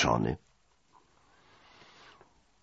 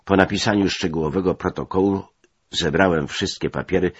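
ordinary delivery, nothing marked

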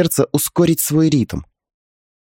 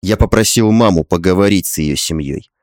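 low male voice; 5 semitones lower than usual. A narrator's reading. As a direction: voice the husband low and tender. Husband voiced in story.

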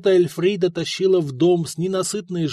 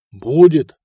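much louder, lively reply; lively answer; 11 dB louder than usual. A narrator's reading. Host answers the neighbour with lively gusto. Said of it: "оживлённо ответил Лотар".

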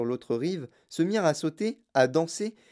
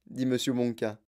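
usual speaking voice

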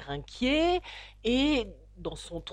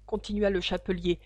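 usual speaking voice

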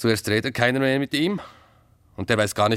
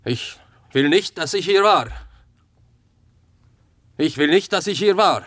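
insistent demand; 6 semitones higher, 4 dB louder than usual. Acted. Eindringliche Forderung nach Geheimhaltung.

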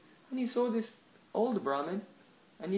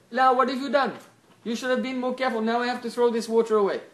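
loud, higher-pitched telling-off; indignant scolding; 6 semitones higher, 8 dB louder than usual. Acted. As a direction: scold someone for their carelessness, loudly. Acted scolding of the other wife.

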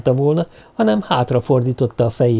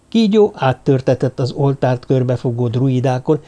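ordinary delivery

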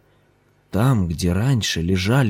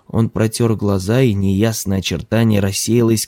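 plain reading voice

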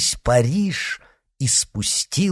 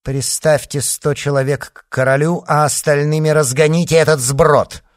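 loud, raised command; imperious command, building louder; commanding order; 4 semitones higher, 6 dB louder than usual. A narrator's reading. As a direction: issue orders with force. Queen orders troops to disperse mob.

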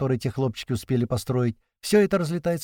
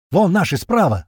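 shout; 6 semitones higher, 6 dB louder than usual. A narrator's reading. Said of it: "голос Сливы".

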